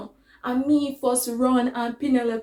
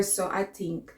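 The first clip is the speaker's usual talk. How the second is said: ordinary talk, nothing marked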